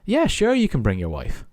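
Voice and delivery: lightly voiced salesman, high-pitched "yeah, sure"; lying phone salesman. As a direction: breezily reassure the caller, starting high then settling. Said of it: "he is lying down the phone".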